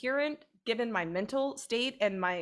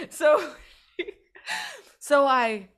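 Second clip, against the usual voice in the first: high laughing restart; can't stop laughing; 5 semitones higher, 4 dB louder, slower than usual. Real, not acted. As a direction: laugh breaks the sentence. Cracking up mid-story.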